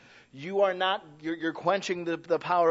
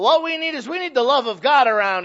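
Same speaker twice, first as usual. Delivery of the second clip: loud raised proclamation; preaching shout; 7 semitones higher, 9 dB louder than usual. Real, not acted. Preacher rallies his congregation, loud and raised. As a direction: lift it up to the whole church.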